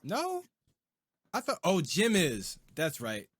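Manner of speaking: normal talking voice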